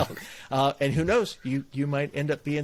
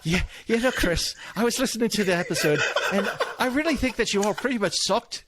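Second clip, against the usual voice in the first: higher-pitched, mock-critical Irish accent; 6 semitones higher than usual. Mock listener panning the podcast episode, in an Irish accent. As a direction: savage the show in a cheerful Irish lilt. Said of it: "That was a spot on accent".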